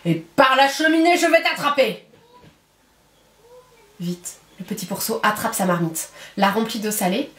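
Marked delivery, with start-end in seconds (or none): 0.0-2.0 a touch of a louder wolf roar; lightly voiced wolf threatening from the roof; the wolf vowing to come down the chimney. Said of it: "rugit le loup".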